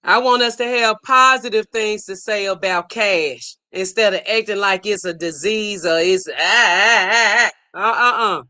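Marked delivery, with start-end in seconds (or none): 6.3-8.4 string of fake panicked cries; hammed-up "ah, ah" of revulsion; mock disgusted wail. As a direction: act out recoiling with short cries. Imitates people treating cash like disease.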